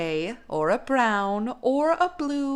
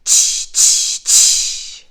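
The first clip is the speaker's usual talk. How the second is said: three loud hissed monkey tsks; loud voiceless "tsh" bursts, evenly spaced; 10 dB louder, much slower than usual. A narrator's reading. Monkeys mocking the peddler, chattering "tsh".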